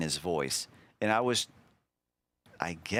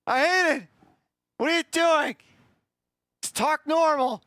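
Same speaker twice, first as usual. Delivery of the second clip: high, loud cringing outburst; loud, pained, high-pitched protest; exasperated yelling; 7 dB louder than usual. Real, not acted. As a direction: yell in real exasperation at your own recorded voice. Cringing at own old voice.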